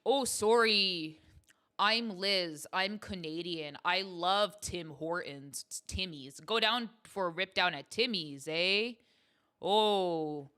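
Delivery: over-the-top Canadian impression, big and slow; hammed-up Canadian accent. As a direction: ham up a cheery Canadian with long, round vowels. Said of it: "Let's be more Canadian".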